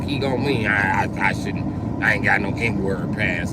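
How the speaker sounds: ordinary talk, nothing marked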